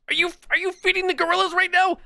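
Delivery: high squeaky mock of the viewer. Streamer mimicking the chatter's gorilla question, high and mocking. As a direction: throw the question back in a silly high voice.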